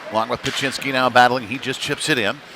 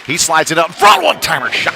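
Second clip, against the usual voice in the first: excited call; 6 semitones higher, 6 dB louder than usual. Real, not acted. Shot on goal, play-by-play.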